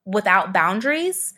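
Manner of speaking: normal talking voice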